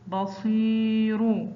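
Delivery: بصير very slowly, صي held long; drawn-out example word. Shown as a demonstration. Teacher showing pupils the long vowel, slow and stretched. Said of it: "ينطق صي".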